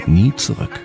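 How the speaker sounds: ordinary delivery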